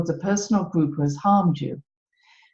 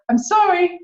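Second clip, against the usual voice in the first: high, louder reflex apology; squeaky automatic sorry; overly apologetic Brit; 12 semitones higher, 3 dB louder than usual. Retold or imitated. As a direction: yelp a startled British sorry. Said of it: "If they tread on your toe".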